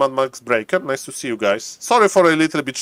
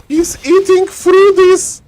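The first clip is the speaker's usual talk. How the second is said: loud, high, drawn-out cry; astonished shout; 13 semitones higher, 6 dB louder, slower than usual. Real, not acted. Caster watching him eating through, astonished high shout. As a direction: shout it slowly in high-pitched disbelief.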